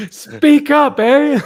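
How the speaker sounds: high, loud call to speak up; a loud high mock yell; mock shout. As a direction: holler it like he can't hear you. Mocking shout at quiet caller.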